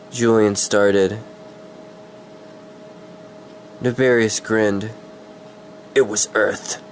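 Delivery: measured fantasy storyteller read; story narration. Acted. Streamer reads his fantasy story to viewers, dramatic narration. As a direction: read it like an audiobook villain reveal.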